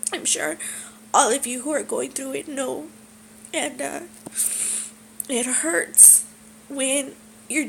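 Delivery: trying to steady a crying voice. Real, not acted. Telling fellow sufferers about a good week, tearful. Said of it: "I don't mean to cry".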